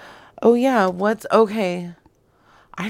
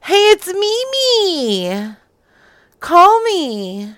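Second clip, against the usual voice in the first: high, loud, drawn-out voicemail squeal; put-on Mimi voice; 11 semitones higher, 11 dB louder, slower than usual. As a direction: leave a silly voicemail, pitched way up and stretched out. Mock voicemail after recorded greeting.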